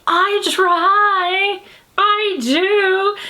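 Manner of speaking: high-pitched, drawn-out protest; mock-whiny self-defence. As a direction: plead your case in a high, stretched voice. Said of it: "I'm like".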